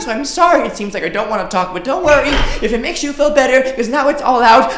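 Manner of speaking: high, loud, fast rush of words; impassioned outpouring. Acted. Student blurting out her feelings to the teacher, impassioned. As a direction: pour it all out at full volume. Performs poem at full force.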